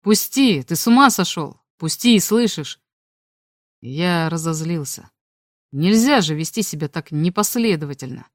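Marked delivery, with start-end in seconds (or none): none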